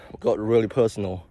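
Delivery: normal talking voice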